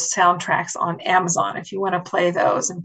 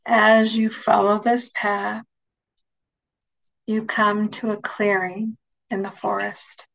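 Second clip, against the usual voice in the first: guided meditation. Acted. Leads a guided imagery meditation.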